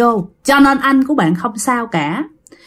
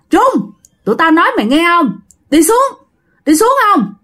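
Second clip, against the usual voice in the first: much higher, urgent demand to come down; calling him down; 6 semitones higher than usual. Retold or imitated. Cousins ordering brother down from the tree, higher and urgent. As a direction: order him down from the bamboo, pitch way up. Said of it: "mấy anh con bác mới kêu rằng".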